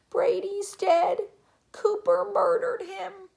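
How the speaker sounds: high, loud, slow-stretched wail; tantruming little boy voice; yelling. A narrator's reading. Little boy pounding the floor over his toy, yelling high. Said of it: "and yelling".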